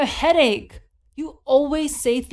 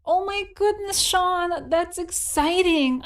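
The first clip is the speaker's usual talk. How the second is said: excited; 6 semitones higher than usual. Acted. Reacting to baby news.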